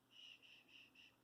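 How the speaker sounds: soft, breathy "shh"; hushing shush. Shown as a demonstration. Teacher showing children quiet in school, soft shushing. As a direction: hush the class gently.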